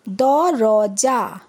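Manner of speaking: দরজা drawn out very slowly, each syllable stretched; slow spelled-out reading. Shown as a demonstration. Teacher showing pupils how to read, very slow drawn-out দরজা. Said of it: "যেরমভাবে আমি পড়লাম".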